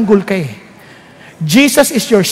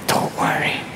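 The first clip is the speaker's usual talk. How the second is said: whisper; 11 dB quieter, slower than usual. Retold or imitated. God comforting in the retelling.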